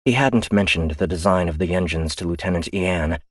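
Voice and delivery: plain reading voice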